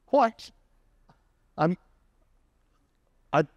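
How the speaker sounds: put-on a kid shocked at no pay; indignant what. Kid learns he won't be paid.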